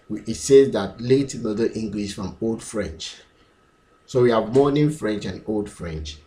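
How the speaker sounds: usual speaking voice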